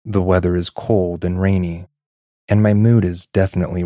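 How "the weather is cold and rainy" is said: usual speaking voice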